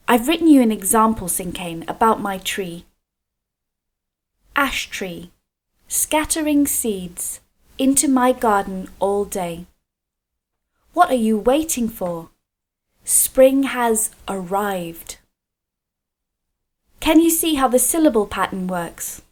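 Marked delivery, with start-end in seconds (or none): 4.6-15.2 slow, measured poem reading with pauses between lines; poem recital. Shown as a demonstration. Teacher reading children an example cinquain about her tree, slow measured recital. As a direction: read it as verse, unhurried, line by line.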